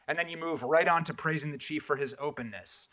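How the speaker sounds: ordinary talk, nothing marked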